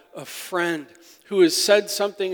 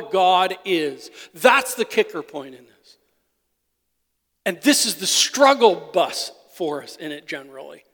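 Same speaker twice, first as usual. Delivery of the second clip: full-force preaching; 5 semitones higher, 8 dB louder than usual. Acted. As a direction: build each line and hit the key words hard. Preaching the psalm to congregation.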